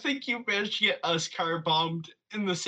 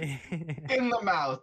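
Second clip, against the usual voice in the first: laughing; much slower than usual. Real, not acted. Laughing add-on.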